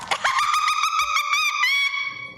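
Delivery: very high squealing laugh; real high-pitched cackle; shrieking laugh. Real, not acted. Shrieks after confessing a crush.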